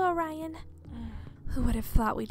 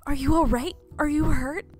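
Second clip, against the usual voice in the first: worried; 3 semitones higher, 7 dB louder than usual. Acted. Rescuer checking on her.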